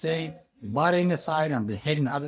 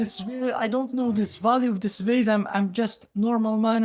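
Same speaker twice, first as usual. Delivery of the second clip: high, halting protest; puzzled simple man, pitched up; bewildered humble man; 8 semitones higher than usual. Acted. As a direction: plead ignorance in a raised voice.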